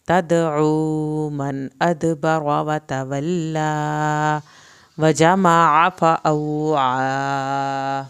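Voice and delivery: slow, loud, drawn-out tajweed chant; Quran recitation. Shown as a demonstration. Teacher recites aloud for students, slow loud chant. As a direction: chant each word slowly for the class to follow. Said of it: "teacher models the recitation".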